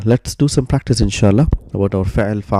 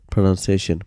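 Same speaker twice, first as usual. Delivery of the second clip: usual speaking voice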